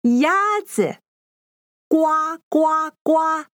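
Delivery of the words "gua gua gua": raised quacking gua; duck quack. Shown as a demonstration. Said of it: "It's the sound of a duck in Chinese".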